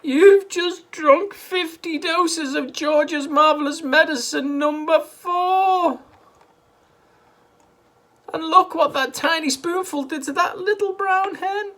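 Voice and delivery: high, loud, slow wail. A narrator's reading. Missus Cranky wailing at her mother, high, loud and slow. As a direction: wail slowly in dismay. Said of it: "wailed Missus Cranky".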